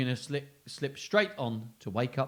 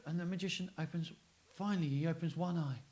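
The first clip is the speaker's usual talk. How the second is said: ordinary delivery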